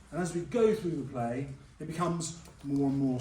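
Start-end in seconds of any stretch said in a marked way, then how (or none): none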